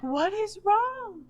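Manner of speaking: high, drawn-out worried question; imitating a concerned grandmother; alarmed grandma. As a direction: ask it slowly and high, mock alarm. Voices worried grandma finding her crying.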